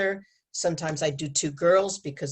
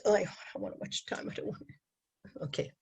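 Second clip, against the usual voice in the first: whispered aside; 10 dB quieter, faster than usual. Real, not acted. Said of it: "we got a few more minutes".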